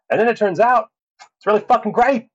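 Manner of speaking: high-pitched, thrilled exclamation; excited reveal. Real, not acted. Telling viewers the dreaded film turned out great, thrilled.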